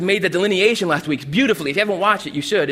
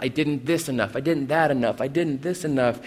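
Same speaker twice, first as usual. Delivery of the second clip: quiet self-reproach; 7 semitones lower, 7 dB quieter than usual. Retold or imitated. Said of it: "There's this constant".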